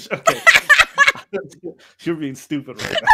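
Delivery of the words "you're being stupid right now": scolding a friend through real laughter; laughing. Real, not acted. Host cracking up at friend's flirty remark, laughing. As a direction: say it while cracking up.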